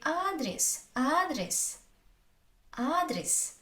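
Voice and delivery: "адрес" as AH-drees, long first a; unstressed е as и. Shown as a demonstration. Teacher demonstrating unstressed е, "адрес" as AH-drees. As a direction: stretch the a in "адрес", short ee after. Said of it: "so I say".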